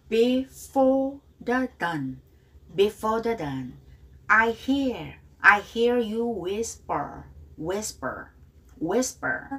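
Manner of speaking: very slow, drawn-out model lines; slow pronunciation model, teacher to class; slow model for repeating. Shown as a demonstration. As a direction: stretch each word for students to copy. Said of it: "Repeat after me".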